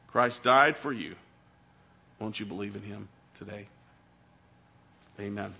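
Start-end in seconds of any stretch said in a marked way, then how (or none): none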